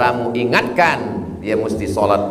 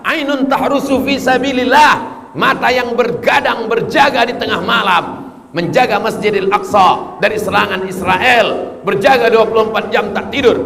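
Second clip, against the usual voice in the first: preaching at full force; 12 semitones higher than usual. Acted. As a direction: cry out the sinner's prayer.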